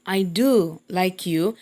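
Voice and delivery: slow, with "do" held long; emphatic, drawn-out "do" in a teacher's demo; stress on DO. Shown as a demonstration. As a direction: stretch "do" and set it apart from "like you". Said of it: "I'm stressing the word".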